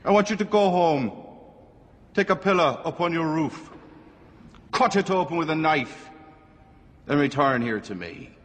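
high-pitched Irish priest giving orders; attempted Irish accent. Priest setting the woman a penance, attempted Irish accent. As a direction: instruct firmly in an imperfect Irish accent. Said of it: "Can't do the Irish accent".

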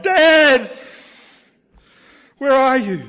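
loud, high anguished shout for Dad, acted out; anguished cry. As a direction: cry out like a lost child in anguish. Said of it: "in anguish, I cried".

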